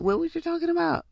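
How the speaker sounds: high, quick, baffled question; confused double take. Acted. Texter rereading old messages, high confused squeak.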